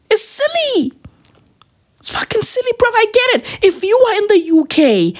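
high-pitched exasperated rant; mock outrage. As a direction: squeak out your disbelief.

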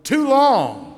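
high loud holler. Retold or imitated. Church lady hollering at the evangelist, loud and high. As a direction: bark it out at the preacher. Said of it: "she abruptly hollered".

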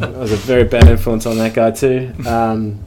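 ordinary delivery

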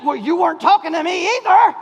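loud, high mock comeback; a husband's daring reply, loud and high; mock retort. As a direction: fire back the retort, hammed up. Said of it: "I don't dare say".

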